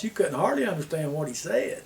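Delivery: normal talking voice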